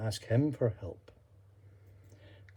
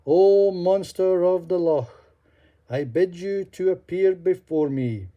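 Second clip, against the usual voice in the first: calling aloud; 7 semitones higher, 7 dB louder than usual. Acted. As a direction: summon the beast across the water. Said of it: "and he said aloud".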